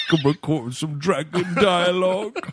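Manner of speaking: slow, laughing dragon actor voice; slow mock dragon drawl with laughter. Continuing the dragon actor bit.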